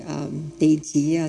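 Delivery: normal talking voice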